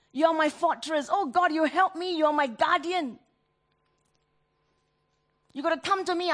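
desperate prayer. Acted. Flopping into the arms of Jesus.

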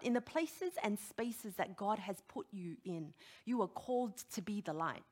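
usual speaking voice

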